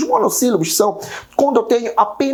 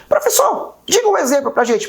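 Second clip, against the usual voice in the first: high-pitched student calling the teacher; high mock-student voice; student asking; 7 semitones higher than usual. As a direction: pipe up like an eager pupil.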